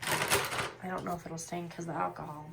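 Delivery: ordinary delivery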